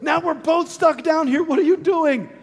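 high-pitched, acted-out outraged yell; livid. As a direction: explode at your friend in exasperation. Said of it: "The man is livid".

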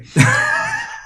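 short, high burst of laughter. Real, not acted. Co-host realizing they forgot supplying the question, high laughing.

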